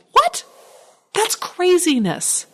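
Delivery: stunned at yourself, voice leaping up; real disbelief, slow on craziness; shocked what. Real, not acted. Shocked at own apology.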